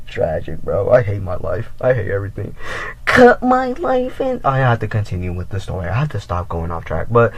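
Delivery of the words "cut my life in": high, slow, hammed-up lament; dramatic mock despair; mock melodrama. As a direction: ham up the tragic line. Melodramatic self-pity joke.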